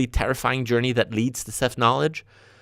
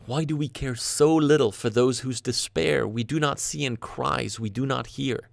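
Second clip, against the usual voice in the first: ordinary delivery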